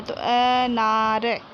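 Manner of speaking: अनार said very slowly with the first sound अ drawn out. Shown as a demonstration. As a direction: stretch अनार so the अ sound is heard. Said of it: "अ की ध्वनि आ रही है". (अनार को धीरे खींचकर, अ पर ज़ोर)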